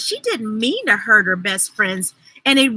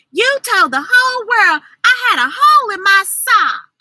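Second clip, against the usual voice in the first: high, louder hurt accusation; 8 semitones higher, 6 dB louder than usual. A narrator's reading. Ruthie Mae accusing her best friend, hurt. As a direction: throw the blame back at her.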